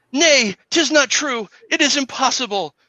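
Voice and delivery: hammed-up heartbroken hero; anguished denial. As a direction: cry out in disbelief.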